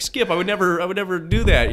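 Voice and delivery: high pleading protest, retold; playing his nervous younger self, voice up high. Pleading innocence to the manager.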